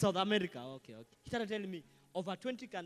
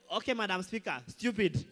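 defiant MP; 3 semitones higher, 10 dB louder than usual. Retold or imitated. MP repeats the insult.

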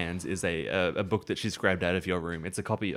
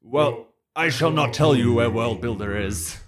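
proud, louder undead servant; defiant villain voice; 4 semitones higher, 7 dB louder than usual. Acted. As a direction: sneer it out as a captured villain. GM voices captive refusing answer.

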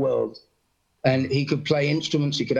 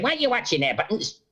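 imitating Mickey; 7 semitones higher than usual. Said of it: "he said".

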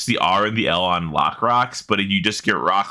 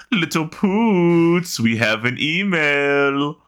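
slow, stretched-out big man voice, higher; big cartoon man; 7 semitones higher, much slower than usual. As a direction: drawl "Little Poots" like a cartoon giant. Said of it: "really big man in Rocko's Modern Life saying".

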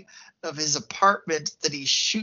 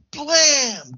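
sharp, loud "blam" up high, like a shot going off; imitated gunshot; 11 semitones higher, 6 dB louder than usual. Retelling the movie scene to a co-host, a loud gunshot sound. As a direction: bark out the gunshot noise. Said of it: "Gunshot takes out the".

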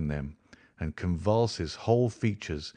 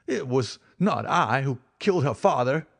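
higher, desperate pleading cry; begging for my life; 6 semitones higher, 3 dB louder than usual. A narrator's reading. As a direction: plead frantically for your life. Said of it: "he cried".

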